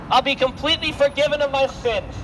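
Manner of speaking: ordinary talk, nothing marked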